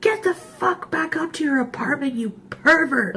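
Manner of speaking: high-pitched mock yell without much volume; upset friend. Retelling the friend chasing off the man, mock furious. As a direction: imitate a friend yelling, pitched right up. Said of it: "She was so upset".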